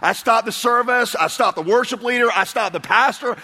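mock-panicked shouting of a startled newcomer; retold panicked yelling. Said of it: "I start screaming".

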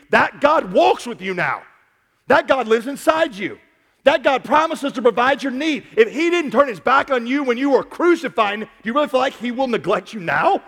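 high, loud preacher's climax; full-force preaching. Acted. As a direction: raise the roof with the question.

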